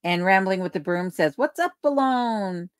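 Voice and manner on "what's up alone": high, stretched-out call of hello; drawn-out greeting. Acted. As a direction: stretch the greeting out, pitched well up. Reading out a viewer's hearty greeting.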